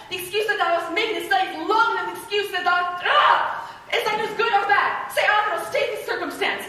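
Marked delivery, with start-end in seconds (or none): 3.0-3.7 high, loud cry of frustration; exasperated shriek. Acted. Juliet kept waiting for news, exasperated shriek.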